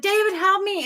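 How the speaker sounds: high, pleading call; cry for help. Retold or imitated. Woman begs her watching partner for help, frightened call. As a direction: call out in reenacted fear.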